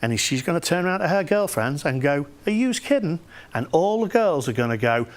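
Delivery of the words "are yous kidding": put-on higher-pitched, incredulous girl's retort. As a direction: act out a girl balking at the idea. Acted girl's incredulous reply to friends.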